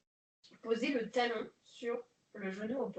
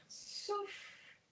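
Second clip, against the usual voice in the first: breathed-out cue; 9 semitones higher, 7 dB quieter than usual. Shown as a demonstration. Instructor cueing her class to exhale, breathy whisper.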